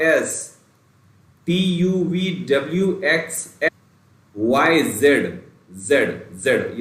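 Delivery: each letter said slowly and clearly, with pauses; slow alphabet drill. Shown as a demonstration. Teacher drilling letters for students, slow and clear. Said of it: "ध्यान दीजिएगा यहाँ पर pronunciation mistake नहीं होनी चाहिए".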